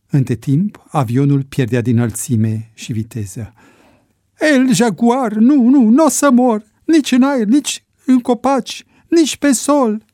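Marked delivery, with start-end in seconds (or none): 4.4-10.0 very high, loud frantic protest; panicked refusal. Acted. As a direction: cry it out as if refusing to die. Acted panic as plane falls. (protest disperat, cu voce înaltă și tare)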